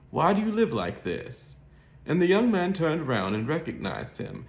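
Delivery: ordinary delivery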